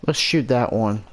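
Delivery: normal talking voice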